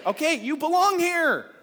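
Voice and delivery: high, drawn-out yell; really loud shout. Shown as a demonstration. Pastor shouts back to congregation, really loud. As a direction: shout it out to the whole room. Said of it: "say it really loud".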